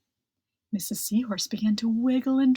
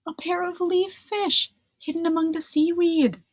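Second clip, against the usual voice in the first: higher, hushed storyteller suspense; 8 semitones higher than usual. A narrator's reading. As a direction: build suspense on hidden danger. Suspense over hidden fish.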